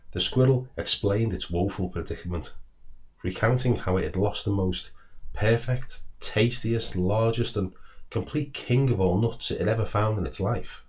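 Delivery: ordinary delivery, nothing marked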